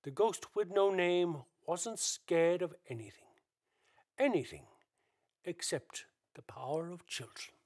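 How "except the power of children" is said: normal talking voice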